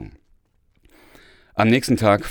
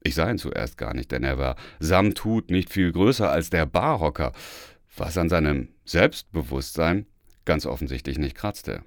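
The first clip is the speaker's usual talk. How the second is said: ordinary delivery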